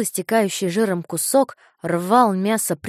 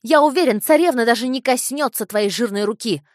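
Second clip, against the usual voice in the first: put-on high, loud taunting boy; shouting boy; 4 semitones higher, 7 dB louder than usual. A narrator's reading. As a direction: jeer at him loudly. Boy keeps shouting insults.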